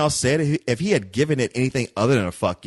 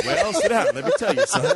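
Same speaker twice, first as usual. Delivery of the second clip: much higher, laughing put-on voice of a know-it-all; mock old-timer advice. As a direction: play a cocky old producer, laughing. Voicing the filmmaker's pitch.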